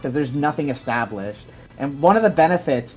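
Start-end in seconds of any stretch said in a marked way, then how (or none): none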